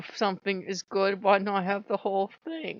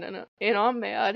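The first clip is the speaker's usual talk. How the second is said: ordinary delivery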